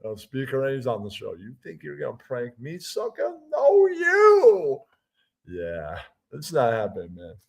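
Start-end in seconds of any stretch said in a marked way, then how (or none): 1.3-4.8 high, slow singsong taunt; mocking taunt. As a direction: tease him in a high sneer. Taunting the prank caller.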